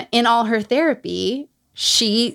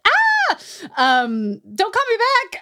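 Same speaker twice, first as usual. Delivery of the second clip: much higher, whimpering mock plea; panicked squeal; 12 semitones higher, slower than usual. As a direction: squeak it out like a scared kid hanging up. Imagining the call going badly.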